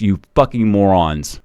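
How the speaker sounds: usual speaking voice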